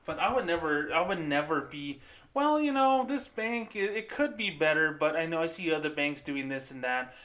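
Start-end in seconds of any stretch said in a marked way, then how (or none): 2.3-7.1 high-pitched hedging, easing down toward normal; mock passive-aggressive. Imitating managers comparing this bank to others, passive-aggressive. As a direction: mimic a manager's roundabout criticism. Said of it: "I don't talk like that".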